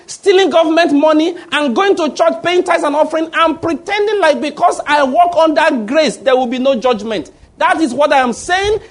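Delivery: high-pitched, driving sermon delivery; fiery preaching. Real, not acted. Preaches at full force.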